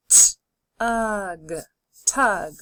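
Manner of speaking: d, ot, dot, parts pulled apart then joined; D, ot, dot: each part separate, then the whole word; segmented then blended. Shown as a demonstration. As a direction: sound it out and blend it. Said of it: "Say the sounds with me and blend together".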